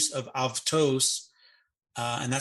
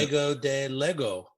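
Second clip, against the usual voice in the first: quoting Jesus in Greek. Retold or imitated. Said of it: "he says".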